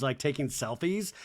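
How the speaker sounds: normal talking voice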